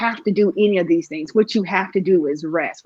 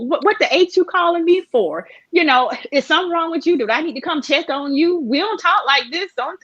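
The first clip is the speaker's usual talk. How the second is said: higher, fussing friend's voice; mock cussing-out in a raised pitch; Kay telling her off; 7 semitones higher than usual. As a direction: imitate an exasperated friend, pitched up and quick. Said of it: "Kay would cuss me out".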